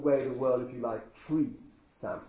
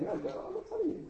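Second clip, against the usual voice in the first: much higher, singsong mock refusal; stubborn refusal; 5 dB quieter, faster than usual. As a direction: tease it like a stubborn child. Samson refusing his nagging wife.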